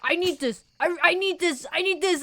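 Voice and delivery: imitating desperate begging; mock desperation. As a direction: beg for it like it's everything. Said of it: "that kind of desperation".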